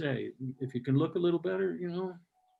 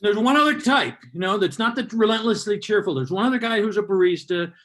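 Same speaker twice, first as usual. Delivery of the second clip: loud comic build; 5 semitones higher, 7 dB louder than usual. Real, not acted.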